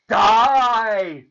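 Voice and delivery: high, loud, drawn-out yell; exasperated wicked-fairy shout. A narrator's reading. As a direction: bellow it at someone half deaf. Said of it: "shouted the garlicky one".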